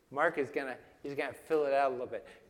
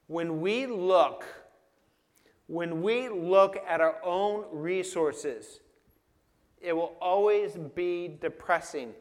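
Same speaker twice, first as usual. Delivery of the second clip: emphatic preaching; 4 semitones higher, 8 dB louder than usual. Acted. Pastor pressing the point to the congregation, emphatic. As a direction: lean hard into each phrase.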